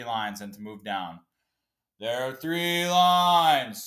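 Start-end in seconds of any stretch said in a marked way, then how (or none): 2.0-3.8 slow, loud, higher mocking drawl of a security guard; condescending security guard. Mocking the airport guard to podcast listeners, slow and condescending. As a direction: spell it out to the crowd like they're idiots. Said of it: "with the most condescending tone".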